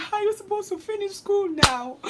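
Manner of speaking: very high, whiny weeping voice; crying hard, imitated. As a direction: wail it as a despairing mother. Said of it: "She was crying hard".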